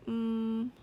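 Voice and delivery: short hum on one even note; brief steady "mm" hum. Shown as a demonstration. Teacher confirms the sound on the page, steady "mm". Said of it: "tell me the sounds again".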